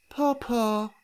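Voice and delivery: put-on high toddler coo; baby babble. Baby lamb stroking her face, high baby coo.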